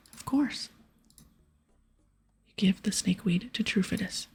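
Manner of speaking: ordinary talk, nothing marked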